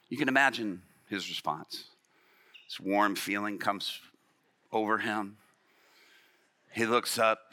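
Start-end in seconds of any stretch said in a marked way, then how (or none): none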